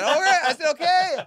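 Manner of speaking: high, loud retold reply; exasperated agreement. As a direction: throw it out loud and high, fed up. Acted exasperated agreement to nervous friend.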